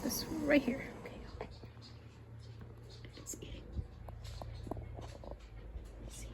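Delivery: breathy hushed whisper. Real, not acted. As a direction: keep it to a whisper. Whispering at the feeder.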